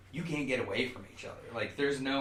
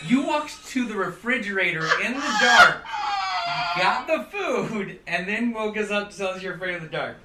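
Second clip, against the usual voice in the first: laughing through the words; cracking up, loud and high; 9 semitones higher, 12 dB louder than usual. Real, not acted. Teasing about midnight snack story.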